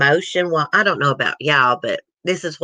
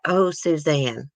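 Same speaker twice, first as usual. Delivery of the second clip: usual speaking voice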